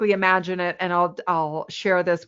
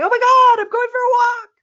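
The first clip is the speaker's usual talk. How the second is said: much higher, louder hammed-up panic; stressed-out mock panic; 5 dB louder than usual. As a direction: squeal it like a walk is a huge event. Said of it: "stressed out people who are going".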